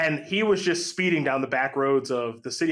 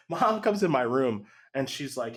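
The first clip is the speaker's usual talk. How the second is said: ordinary talk, nothing marked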